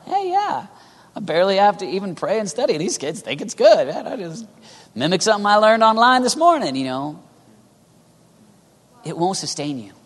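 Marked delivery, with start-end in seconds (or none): 0.0-6.8 high, swooping put-on voice; hammed-up carefree boast, climbing higher and louder; mock breezy bragging. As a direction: imitate a shallow minister happily coasting along. Mimics shallow, breezy youth minister.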